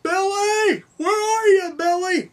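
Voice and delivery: high, drawn-out searching call; mock calling out. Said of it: "calling out to other people".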